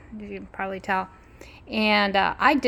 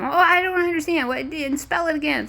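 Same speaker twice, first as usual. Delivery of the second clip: confused guy; 9 semitones higher, 4 dB louder than usual. Retold or imitated. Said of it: "the guy was going".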